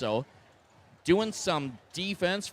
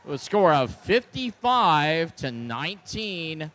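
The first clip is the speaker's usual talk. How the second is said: announcer reveal; 11 dB louder than usual. Real, not acted. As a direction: stretch it out like a big reveal. Announcing the winning alliance.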